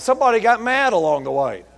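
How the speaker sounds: ordinary talk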